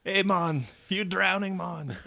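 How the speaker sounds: high put-on Jamaican voice; mock Jamaican accent, teasing. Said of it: "a guy with a Jamaican accent".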